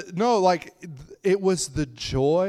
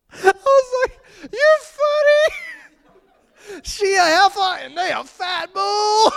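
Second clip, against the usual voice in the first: crying with laughter, high and loud; real helpless laughter; laughing; 7 dB louder than usual. Real, not acted. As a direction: shriek it through tears of laughter. Overcome with laughter.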